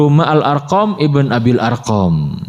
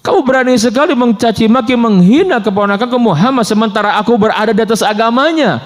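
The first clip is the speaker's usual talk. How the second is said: heated rebuke; 7 semitones higher than usual. Retold or imitated. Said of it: "keceplosan ngomong begitu".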